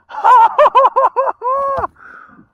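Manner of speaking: high, loud cackle of joy; screaming laugh. Real, not acted. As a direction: let the laugh burst out.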